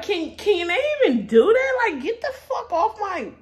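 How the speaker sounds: high, loud, laughing disbelief; half-laughing, half-furious protest; cracking up while indignant; laughing outrage. Retold or imitated. Outraged at being fired.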